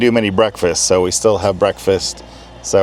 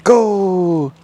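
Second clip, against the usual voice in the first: yelling from the sidelines; 11 semitones higher than usual. Real, not acted. Cheering running dogs.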